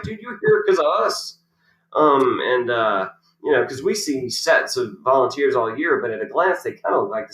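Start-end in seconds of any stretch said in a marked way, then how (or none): 0.0-1.4 high-pitched retort, retold; indignant comeback. Player answering the volunteer back, indignant. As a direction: throw it back at him, mock indignant.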